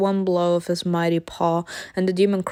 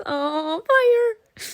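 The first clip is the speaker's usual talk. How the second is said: high thrilled cry on fire; excited cry; 14 semitones higher than usual. Real, not acted. Player cheers the dragon on, high excited cry. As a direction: squeal it with glee.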